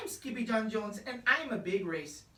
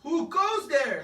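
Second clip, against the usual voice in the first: a louder holler out into space; 4 semitones higher, 6 dB louder than usual. A narrator's reading. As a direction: call out to the passing comet. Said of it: "hollered Scapito".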